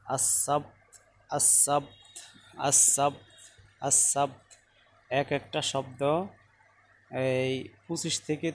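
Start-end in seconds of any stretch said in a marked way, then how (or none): 0.1-4.6 আসাবত spoken clearly with a crisp final t; clear pronunciation. Shown as a demonstration. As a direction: articulate আসাবত distinctly for students. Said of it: "স্পষ্ট করে বলতে পারো". (স্পষ্ট করে আসাবত)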